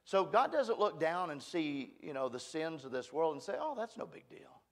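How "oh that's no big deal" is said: high, soft, offhand brush-off; mock dismissiveness. Preacher voicing what God never says about sin, mock dismissive. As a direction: toss it off lightly as if unbothered.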